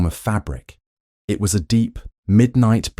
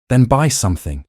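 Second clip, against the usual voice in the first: a sharp, higher, louder snap; snapped; 6 semitones higher, 4 dB louder than usual. Acted. Duke to wife over breakfast, not looking up, snapping. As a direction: bite the words off impatiently. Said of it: "Sebastian snapped".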